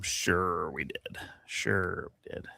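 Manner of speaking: low, soft, slow sarcasm; deadpan it under your breath; sarcastic mutter. Real, not acted. Podcaster mocking the liberation claim with quiet sarcasm. Sarcastic reply to own quote.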